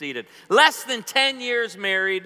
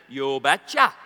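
put-on thick Norwegian accent; 6 semitones lower, 3 dB louder than usual. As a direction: lower Norwegian-accented voice, lifting at the end. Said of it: "he responded in his thick Norwegian accent".

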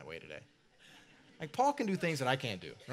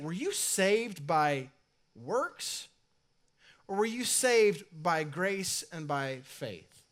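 drawn-out contrast on "works" and "grace"; slow pointed question; 3 semitones higher, much slower than usual. Acted. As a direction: press each word slowly, stressing "works". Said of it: "He's saying".

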